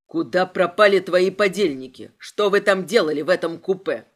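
low, loud demands; forceful low-pitched inspector; angry interrogation. A narrator's reading. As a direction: fire the questions low and hard.

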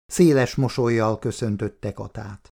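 ordinary delivery

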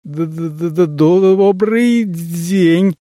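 terrified stutter, dragged out; stammering. Acted. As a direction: stammer it out in fright. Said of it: "пролепетал он, еле ворочая языком". (заикаясь от страха)

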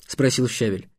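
ordinary delivery, nothing marked

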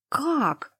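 high-pitched fake shock; theatrical mock surprise; overacted gasp. A narrator's reading. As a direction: overdo the astonished little gasp. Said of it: "переигрывая, охнула я".

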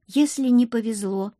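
plain reading voice

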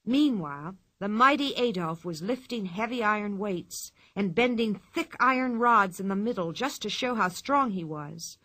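plain reading voice

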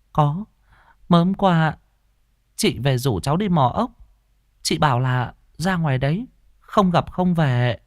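put-on high child voice; little girl's answer. Little girl answers in story.

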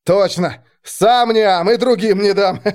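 high, loud rowdy soldier glee. Acted. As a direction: crow about it like a rough soldier. Second soldier agrees loudly.

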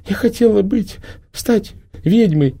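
put-on high, slow tearful girlish voice; through tears. A narrator's reading. The girl tells Prima she wanted to be a witch, through tears. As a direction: speak through sobs like a little girl. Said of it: "сквозь слёзы донеслось".

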